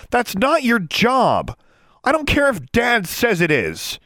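slow, high, loud yell; fierce high-pitched retort; yelled fiercely. A narrator's reading. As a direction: yell back in fury. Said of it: "she yelled fiercely".

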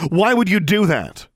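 high, loud hurt outburst; why, pleading in fury; angry demand. A narrator's reading. Alexandra confronting Darla, high loud outburst.

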